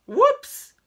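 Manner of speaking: high, loud exclamation; startled whoops. Acted. Storyteller as the hat slips off, startled high whoops. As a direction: yelp a sudden "whoops".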